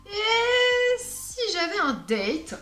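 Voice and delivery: slow, high, playful flirt; mock seductive. Talking to her perfume, a playful slow seductive line. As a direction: woo the bottle like a date.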